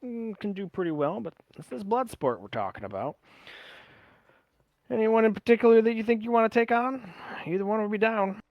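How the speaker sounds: a hint of a promoter sounding out his fighter; drow fight organiser. Voices the drow weighing the matchup.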